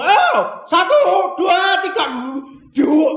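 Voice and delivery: loud, high call to row; rally the crew with a shout; counting shout. Acted. Disciples count off rowing together. (para murid berhitung sambil berteriak keras)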